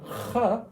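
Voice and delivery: "kh" scraped at the back of the throat; Arabic throat sound. Shown as a demonstration. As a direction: rasp "kh" as a throaty kha. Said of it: "Arabic has throat sounds".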